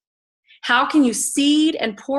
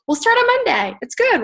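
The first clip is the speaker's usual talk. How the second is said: high-pitched, carefree imitation of a procrastinator; mock breezy dieter; 13 semitones higher than usual. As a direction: brush it off in a high mock-cheerful voice. Mimics dieters putting off their start.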